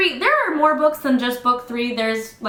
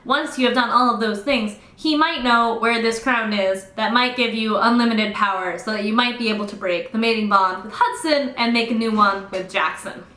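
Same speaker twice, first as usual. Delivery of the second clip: usual speaking voice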